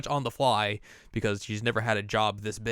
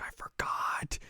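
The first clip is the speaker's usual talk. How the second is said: whispered mock confession; hushed, helpless admission; sheepish whisper; 6 dB quieter, slower than usual. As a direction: whisper it as if embarrassed. Director's embarrassed confession, retold.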